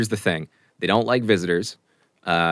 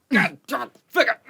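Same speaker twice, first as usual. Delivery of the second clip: sudden high curse from the halfling; halfling cursing; 4 dB louder than usual. Acted. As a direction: blurt it out, much higher and louder. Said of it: "he's just like".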